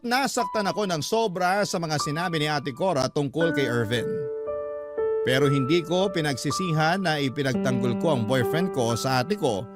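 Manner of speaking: usual speaking voice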